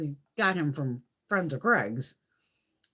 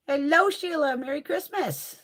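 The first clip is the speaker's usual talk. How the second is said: high, louder pet greeting; greeting the cat; 10 semitones higher, 4 dB louder than usual. Real, not acted. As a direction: coo a hello to the cat.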